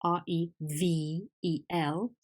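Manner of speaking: R E V E L letter by letter, slowly; slow, separate letters for R E V E L; spelled out. Shown as a demonstration. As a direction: spell R E V E L with a distinct V. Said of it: "when it's spelled with V".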